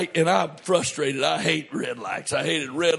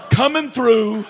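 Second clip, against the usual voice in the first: mock call to clear the road, higher and louder; playful call-out; 5 semitones higher, 6 dB louder than usual. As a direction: call out as if parting traffic. Acting a ship-bow car clearing traffic.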